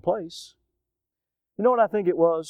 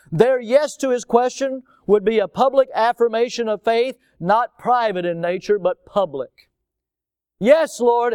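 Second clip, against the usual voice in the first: raised preacher's voice building to "Yes, Lord"; preaching at full force; 6 semitones higher, 4 dB louder than usual. Real, not acted. Pastor to his congregation about a public yes, preaching loud. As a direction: preach it out loud and high to the pews.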